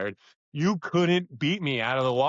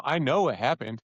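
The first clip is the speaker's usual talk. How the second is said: normal talking voice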